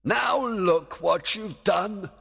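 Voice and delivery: high, slow, loud scolding; exasperated fairy king's shout; angry. Acted. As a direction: shout it at a bungling servant. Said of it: "Oberon pointed angrily".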